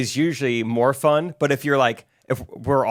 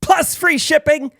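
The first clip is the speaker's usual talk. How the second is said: hyped promo read; 8 semitones higher than usual. Pretend. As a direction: sell the deal like an infomercial. Hyped reading of the promo code.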